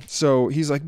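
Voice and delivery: normal talking voice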